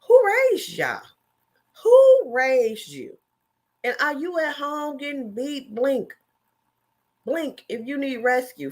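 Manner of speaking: high, loud disbelieving call-out; incredulous shouting. Retold or imitated. Vlogger calling out commenters, loud and high. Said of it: "it just be over there like".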